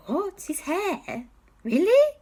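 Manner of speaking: high squeaky disbelief, rising; what, his hair?, squeaked in shock; incredulous. Acted. Auntie Lee can't believe the wizard's weakness, high incredulous squeak.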